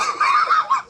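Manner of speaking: high, shouted laugh; shrieking laugh. Real, not acted. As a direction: burst into a screaming laugh. Right after shouting.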